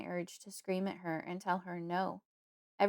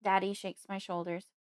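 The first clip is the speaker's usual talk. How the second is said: plain reading voice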